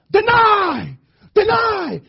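high, forceful shout; coach's yell. Retold or imitated. Basketball coach yelling the play to players, loud high yell. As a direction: yell it drawn out like a command from the sidelines. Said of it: "He would yell".

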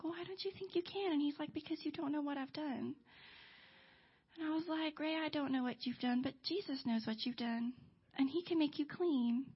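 soft, hushed confiding; gentle retold exchange. Staff member and Ray talking privately, softly and tenderly. Intimate retold confession and assurance.

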